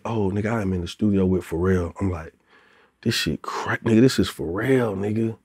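quiet, amazed aside; hushed awe. Retold or imitated. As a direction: say it under your breath, starstruck. Awed in the studio.

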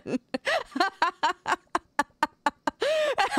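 real high-pitched laughing fit; high burst of laughter. Real, not acted. Laughing at own mistake.

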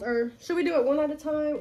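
ordinary talk

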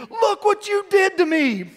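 high, slow mock wail of blame; hammed-up labor complaint; mock blaming wail. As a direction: drag it out like a suffering wife. Wife blaming him in labor.

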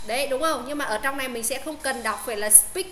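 ordinary delivery

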